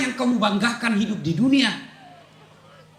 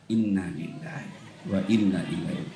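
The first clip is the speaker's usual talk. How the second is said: soft walking-away innalillahi; 7 dB quieter than usual. Shown as a demonstration. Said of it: "lalu kita tinggalkan".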